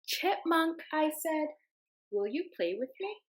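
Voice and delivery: ordinary talk, nothing marked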